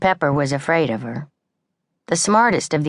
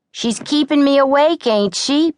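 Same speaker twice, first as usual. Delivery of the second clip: higher, louder snap; snapping back; 11 semitones higher, 5 dB louder than usual. A narrator's reading. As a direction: bite back at July. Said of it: "Pepper snapped back at July".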